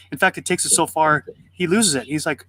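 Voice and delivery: ordinary talk, nothing marked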